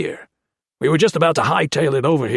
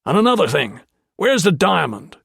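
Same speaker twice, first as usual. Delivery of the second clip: demanding; 6 semitones higher, 4 dB louder than usual. A narrator's reading. Commander demands the diamond.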